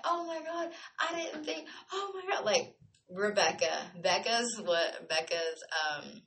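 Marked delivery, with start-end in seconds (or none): 0.0-2.4 high mock delighted oh my God; excited friends. Friends spotting her back in town, high excited. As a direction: voice friends thrilled to see her.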